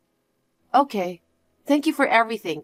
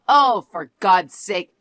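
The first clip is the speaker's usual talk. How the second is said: usual speaking voice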